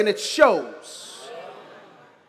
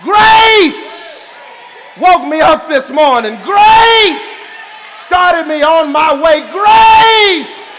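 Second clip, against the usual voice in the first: shouted, drawn-out preaching on grace; whooping preacher's climax; 7 semitones higher, 10 dB louder, slower than usual. Acted. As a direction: build the praise to full force.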